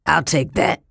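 a gruff, threatening grab; snarled. Acted. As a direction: thief snarling as he snatches the bucket. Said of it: "he snarled".

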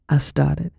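ordinary delivery

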